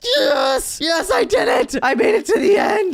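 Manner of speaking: very high screaming celebration; loud, real triumphant yelling; ecstatic victory shout. Real, not acted. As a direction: scream with joy at finally winning. Celebrates reaching the end.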